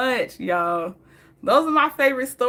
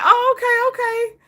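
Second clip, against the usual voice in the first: dad's high reply; 13 semitones higher, 4 dB louder than usual. Retold or imitated. Dad quoted, high and loud.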